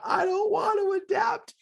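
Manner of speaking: put-on very high squealed toddler protest; tantrum. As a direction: mimic a small kid's tantrum whine. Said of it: "he's throwing a tantrum about adapting".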